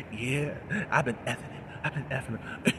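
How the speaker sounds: low, soft sarcastic admission, lightly voiced; sarcastic retort. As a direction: toss it back quietly with mock candor. Jillian voiced in recap quarrel.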